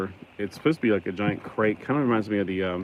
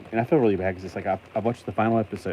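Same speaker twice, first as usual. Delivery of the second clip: ordinary delivery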